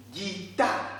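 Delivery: "guitare" split slowly as gui... tare, rising; "guitare" as ghee, then tar; syllable by syllable. Shown as a demonstration. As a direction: sound out "guitare" in separate beats for learners. Teacher splits word into syllables.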